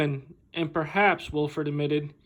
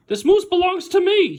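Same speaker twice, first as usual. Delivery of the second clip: high, loud, insistent claim; indignant little boy; 13 semitones higher, 5 dB louder than usual. Acted. Boy correcting the old lady, high and insistent. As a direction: plant your flag on it. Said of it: "he explained".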